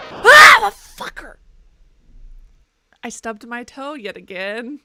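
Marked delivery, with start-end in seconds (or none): 0.1-1.4 high, loud cry of sudden pain; pained yelp. Real, not acted. Streamer stubs a toe and yelps in pain. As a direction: yelp and curse at the hurt toe.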